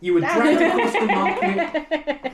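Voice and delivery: high, laughing mid-sentence. Real, not acted. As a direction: narration breaking into laughter. GM laughing through narration.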